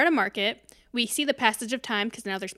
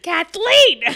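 high, loud mock scolding shout; playfully outraged yell; exasperated yell; 9 semitones higher, 13 dB louder than usual. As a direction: holler the name in disbelief. Yelling at the film character.

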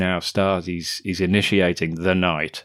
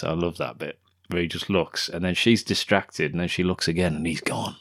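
ordinary talk, nothing marked

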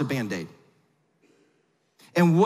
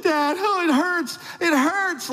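put-on much higher whiny voice of a hurt kid; high, pained kid crying out to his dad; son in pain; 11 semitones higher, slower than usual. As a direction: play the son wailing over his stubbed toe. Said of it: "He's like".

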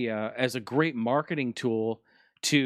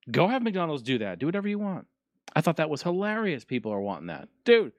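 usual speaking voice

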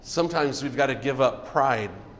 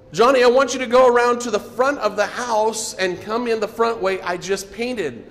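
mild retold yell; 6 semitones higher than usual. Missionary calling to a running child, mild mock yell. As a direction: call out to the boy from the side of the house. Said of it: "she yelled at him from the side".

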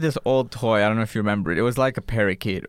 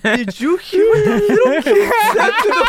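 much higher, laughing scandalized gasp; shocked cashier; 7 dB louder than usual. Retold or imitated. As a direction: cashiers gossiping in shock about the kid. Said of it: "They were like".

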